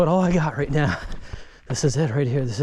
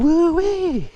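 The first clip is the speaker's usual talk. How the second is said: retold gleeful scream; 10 semitones higher, slower than usual. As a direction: cheer the boy on as the stroller rolls. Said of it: "And I'd scream".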